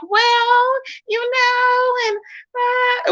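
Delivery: high, sing-song tipsy voice; drunk Allie imitation. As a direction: croon it like a tipsy friend. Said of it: "And Allie's like".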